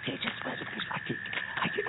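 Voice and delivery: soft whisper. Acted. Bucky stopping Betty near the dogs, whispering. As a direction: hold her back in a whisper. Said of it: "we gotta be real quiet now".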